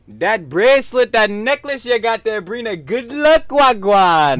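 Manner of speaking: high, loud hammed-up hawker voice; mock Jamaican-style vendor hype. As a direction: shout it like a pushy street seller.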